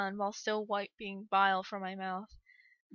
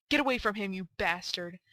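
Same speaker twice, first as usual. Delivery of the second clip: angry snap. A narrator's reading. Parent to monster standing over James, angry snap. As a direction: snap a warning at an attacker. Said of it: "I snapped".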